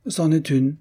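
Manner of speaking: casual, nonchalant aside; offhand. A narrator's reading. Husband casually boasting, offhand. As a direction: toss it off as nothing. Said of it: "dit négligemment monsieur d'Argonne".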